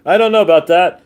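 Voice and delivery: emphatic doubtful repeat; loud doubt. Shown as a demonstration. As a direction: say it out loud, skeptical.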